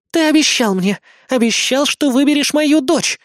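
high, loud accusing cry; furious mother scolding the cheater; angry growl. Acted. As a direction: hurl the broken promise at him. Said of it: "прорычала Азуми".

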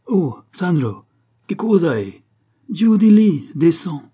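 loud, high call up to the scaffold; an inspector calling a worker down from the scaffold. Acted. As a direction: shout up to Sandro to get him down. Calls foreman down from scaffold.

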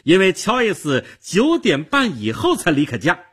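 heated, high-pitched rebuttal; loud cry. Acted. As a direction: blurt out the contradiction. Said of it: "戴安娜高声地喊道".

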